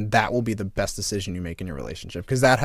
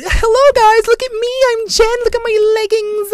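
much higher, louder mocking falsetto of his wife; mocking show-off voice; 9 dB louder than usual. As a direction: ham up a vain gym girl showing off her leggings. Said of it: "she'll go to the gym and be like".

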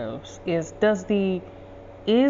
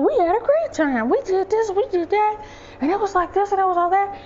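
high, excited rush of news; mock gushing, pitched way up; 13 semitones higher, 3 dB louder than usual. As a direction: imitate kids bubbling over about an outing. Acting out siblings' gushing report.

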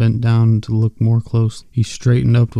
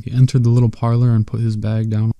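ordinary delivery

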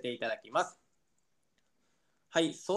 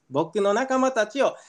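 usual speaking voice

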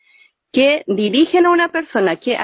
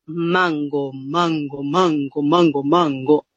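much lower and softer mango, mango, from the belly; low voice demo; 8 semitones lower, 3 dB quieter, slower than usual. Shown as a demonstration. Teacher showing the low tone from the belly. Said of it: "que es el tono bajo".

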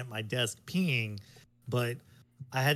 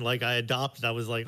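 normal talking voice

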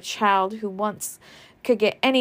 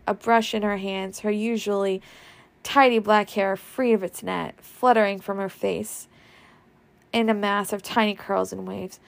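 ordinary delivery, nothing marked

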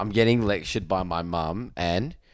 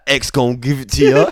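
shouted lyric; 5 semitones higher, 9 dB louder than usual. Pretend. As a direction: blurt the lyric out loud, laughing. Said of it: "you impulsively shout out".

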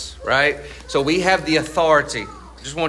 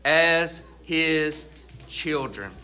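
preaching; 5 semitones higher, slower than usual. Acted.

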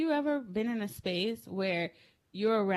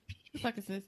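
softer, understated grandma demand; imitated grandmother; 7 dB quieter, faster than usual. Grandmother questioning child about the poop, lightly imitated. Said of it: "This is how she talks".

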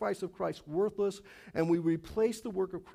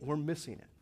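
ordinary talk, nothing marked

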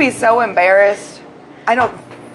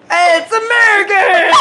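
mock cheer; 8 semitones higher, 3 dB louder than usual. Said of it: "was like".

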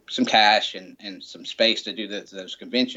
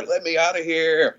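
mock yell; 6 semitones higher, 4 dB louder than usual. Passenger yelling from crammed car.

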